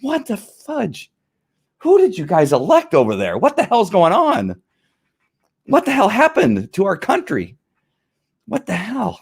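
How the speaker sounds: high, slow, incredulous what-the-hell; exasperated outburst. Retold or imitated. Ex-officer reliving his anger at the press conference, high exasperated disbelief. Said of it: "I was so angry today watching".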